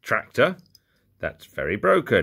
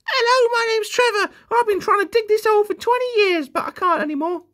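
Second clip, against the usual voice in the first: very high, loud, squeaky little workman; toy workman Trevor; 5 dB louder than usual. Pretend. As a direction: strain it out in a comic falsetto. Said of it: "There's a bloke in there".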